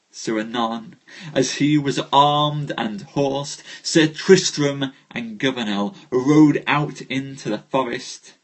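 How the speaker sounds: measured storyteller reading a knightly tale; story narration. Acted. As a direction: tell the legend to the live audience. Live reading of Arthurian tale.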